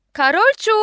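loud high call of a name. A narrator's reading. Mother calling her missing daughter, loud. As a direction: shout the name across the flat.

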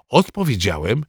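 ordinary delivery, nothing marked

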